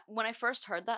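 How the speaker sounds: ordinary delivery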